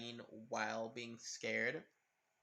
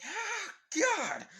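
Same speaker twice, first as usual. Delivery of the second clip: high, loud startled yelp; startled scream; 8 dB louder than usual. Acted. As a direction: shriek as if jumped from behind. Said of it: "Karin screaming".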